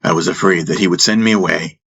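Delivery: plain reading voice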